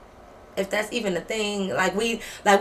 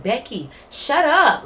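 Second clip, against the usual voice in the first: louder, slow, drawn-out scolding; acted telling-off; 4 semitones higher, 6 dB louder, slower than usual. Telling the screaming roommate to stop, loud exasperated scolding. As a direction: cut through her screaming, higher and slower.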